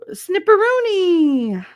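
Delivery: high, loud, drawn-out nickname; singsong call-out. Real, not acted. Streamer greeting a viewer by nickname, calling out. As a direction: holler the nickname, stretched and falling.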